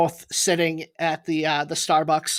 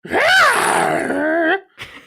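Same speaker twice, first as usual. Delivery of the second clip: high, loud dragon shriek; dragon noise; 14 semitones higher, 4 dB louder than usual. Pretend. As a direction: screech like an upset dragon. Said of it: "your best dragon impression or noise".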